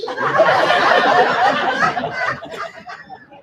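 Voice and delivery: real high laugh; high burst of laughter. Real, not acted. Speaker laughing at the fish punchline, high laugh. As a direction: crack up at your own story.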